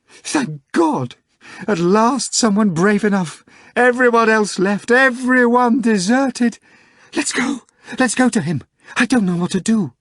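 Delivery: high, loud, desperate screaming; screaming in distress. A narrator's reading. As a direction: shriek it out, frantic and pleading. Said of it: "began to shake him and scream".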